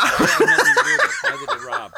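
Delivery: very high falsetto squeak; hammed-up high voice denial; mock squeaky denial. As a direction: squeak your innocence in a silly falsetto. Host denies with laughing squeak.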